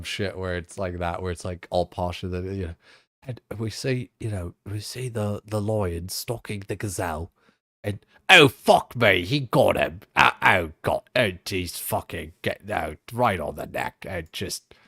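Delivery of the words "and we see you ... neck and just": David Attenborough. Retold or imitated. Streamer imagines a wildlife narrator losing composure, hushed then excited. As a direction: start as a calm documentary voice and let the kill carry you away. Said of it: "David Attenborough".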